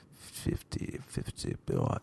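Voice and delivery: breathy whispered aside; whispering. Real, not acted. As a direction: whisper it under your breath. Whispered aside on podcast age.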